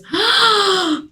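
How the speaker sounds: a sudden, high startled gasp; sharp high gasp. Retold or imitated. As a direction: gasp in shock at the news. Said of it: "She goes".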